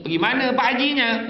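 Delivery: mock shrill wife asking where he goes; a rude wife's high, loud question; high, loud nagging wife. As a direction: nag him high and loud. Rude wife asks husband.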